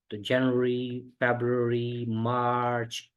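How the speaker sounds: जनवरी, फरवरी, मार्च said slowly, syllable by syllable; slow clear demo of the month names; careful pronunciation. Shown as a demonstration. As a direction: model each month slowly for the class. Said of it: "month में भी बहुत सारे लोग गलती करते हैं".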